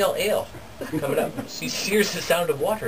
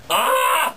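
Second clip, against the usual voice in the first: high mock scream; falling cry; 14 semitones higher, 3 dB louder than usual. Storyteller as Jakob heard falling inside, high cry. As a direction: yelp high as if dropping. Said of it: "inside she hears".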